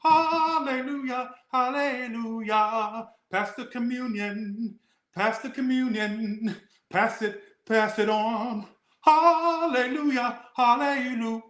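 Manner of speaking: a prim, prissy chant of hallelujah; prim churchgoer. Retold or imitated.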